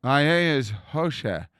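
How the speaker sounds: slow, lipless My name is Hoshe; no lips. Retold or imitated. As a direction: say Hoshe for Moshe, no lips used. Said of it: "'cause he could not say Moshe".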